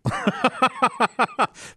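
high chuckle; quick, high, rattling giggle; laughing. Real, not acted. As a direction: laugh at how grim it is. Laughs at how brutal book is.

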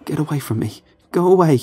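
rising to a high loud yell; panicked yelling. Acted. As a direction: scream him away in panic. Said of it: "I cried".

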